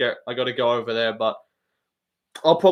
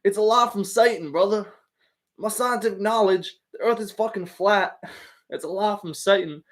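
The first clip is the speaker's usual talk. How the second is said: put-on higher hillbilly accent, preachy; Bryce Mitchell hillbilly voice; 6 semitones higher than usual. Streamer doing Bryce Mitchell's flat-earth talk, hillbilly accent. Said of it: "inbred hillbilly voice mode".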